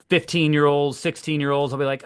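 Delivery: usual speaking voice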